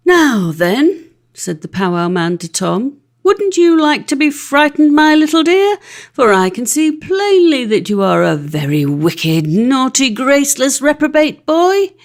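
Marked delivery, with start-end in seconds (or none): 0.1-1.1 menacing powwow man. Acted. 3.2-11.9 higher, louder mock-sweet threat to a wicked boy; taunting powwow man.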